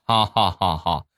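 slow, spelled-out ha-ha laugh; mock laugh. Best friend revealing her prank, mock laugh. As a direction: laugh in separate, deliberate beats. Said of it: "她闺蜜就说".

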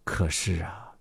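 plain reading voice

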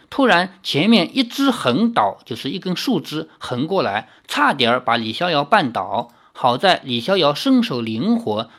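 ordinary delivery

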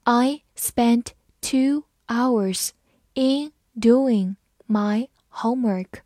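slow, chunked read. Shown as a demonstration. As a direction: read it slowly for learners. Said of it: "我们慢慢来读". (慢速朗读)

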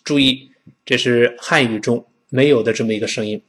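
ordinary delivery

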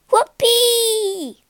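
a very high, loud whoop of joy; whoopee cry. Acted. Pinocchio zooms on a roller coaster, loud high whoop. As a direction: whoop like you're flying down the coaster. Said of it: "cried Pinocchio".

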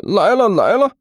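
high-pitched call toward the door. A narrator's reading. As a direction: holler back that you're on your way. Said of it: "对着外面大声喊道".

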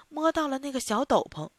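usual speaking voice

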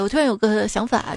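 normal talking voice

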